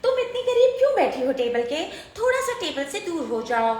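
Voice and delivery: high squeaky cartoon kid voice. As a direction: talk like a cartoon child. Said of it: "cartoon वाली आवाज़ निकाल के दिखाओ".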